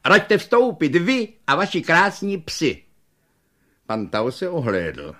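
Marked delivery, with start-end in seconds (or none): 0.0-2.8 high, louder fawning invitation; bow them in like a sweet salesman; welcoming shopkeeper. Acted. Little shopkeeper inviting Pan Tau and dogs in, high fawning. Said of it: "řekl lidským hlasem".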